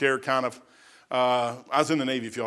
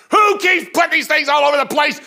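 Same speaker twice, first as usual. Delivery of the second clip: much higher and louder angry yell; mock angry sergeant; 11 semitones higher, 7 dB louder than usual. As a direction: bellow it like a fuming staff sergeant. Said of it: "he'd get so mad".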